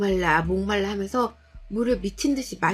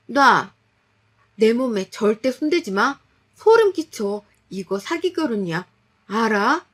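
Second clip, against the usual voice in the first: agitated shouting; 6 dB louder than usual. Acted. Wife refusing husband's touch, shouting furiously. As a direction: push him away, yelling. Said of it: "제가 한참 흥분해서 난리치니".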